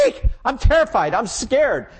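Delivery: high, loud squealing fake fright; mock terror. Cow pretends to panic at mousetrap, high and loud. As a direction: shriek in hammed-up panic.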